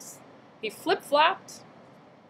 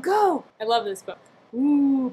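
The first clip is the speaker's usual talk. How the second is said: ordinary talk, nothing marked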